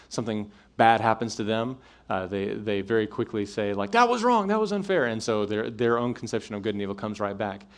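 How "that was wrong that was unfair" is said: much higher, louder cry of being wronged; indignant protest. Retold or imitated. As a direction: protest like someone just cheated.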